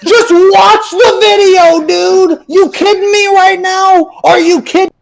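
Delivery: high, slow, half-laughing whine of disbelief; mock exasperation. Streamer mockingly rants at a lazy viewer comment, stretched and incredulous. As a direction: drag it out like you can't believe this guy.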